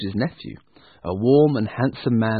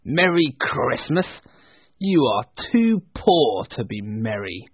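scornful Scrooge. Acted. Scrooge mocking his poor nephew, scornful. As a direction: draw out the greeting with contempt. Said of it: "said Scrooge".

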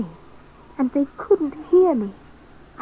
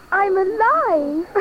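joyful; 6 semitones higher, slower than usual. A narrator's reading. Joy at being found.

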